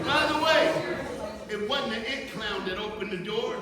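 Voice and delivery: high, loud, worked-up storytelling; big hollered comic aside; comic shouting. Real, not acted. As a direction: shout it over a laughing crowd.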